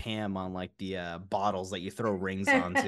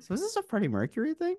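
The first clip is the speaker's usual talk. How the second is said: high, fast mock mom voice; light, high impression of a curious mother; imitating mom; faster than usual. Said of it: "for her to be like".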